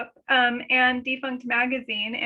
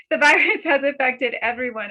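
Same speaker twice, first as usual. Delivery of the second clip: real amused restart; 2 semitones higher, 5 dB louder than usual. Real, not acted. Speaker redoing a fluffed line, amused restart. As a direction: laugh breaking into the first words.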